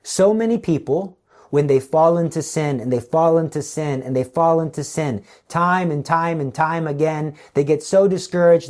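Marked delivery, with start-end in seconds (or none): none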